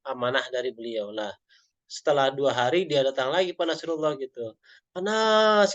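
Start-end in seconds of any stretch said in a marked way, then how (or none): 4.9-5.7 high, loud call of a name; excited call. Retold or imitated. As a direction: call out the name, bursting with news. Friend returns calling out happily.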